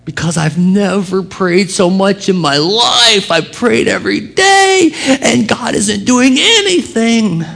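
high, whiny complaint; imitating an exasperated believer; frustrated believer. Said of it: "Why are you frustrated".

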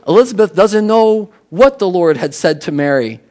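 ordinary talk

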